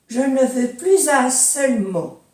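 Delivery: "plus un" linked as "plyz un"; liaison S as Z. Shown as a demonstration. Teacher demonstrating liaison, S sounded as Z. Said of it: "Con la liaison".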